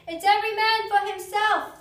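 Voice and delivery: loud, high bellow to the crew; captain's shout. A narrator's reading. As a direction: roar it over the panic. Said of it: "the captain shouted".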